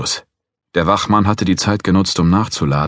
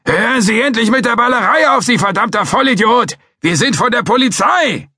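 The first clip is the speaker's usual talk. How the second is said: furious high-pitched yell at full volume; bellowing; 4 dB louder than usual. Acted. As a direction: bellow it at the trigger-happy guard. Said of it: "brüllte er schon".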